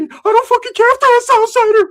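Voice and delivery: a shrill imitation of the friend; Ricky's voice. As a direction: squeal it like Ricky. Imitating Ricky mid-list.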